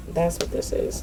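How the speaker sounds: breathy whisper to herself; whispered aside. Real, not acted. Muttering to herself during the reading, whispered.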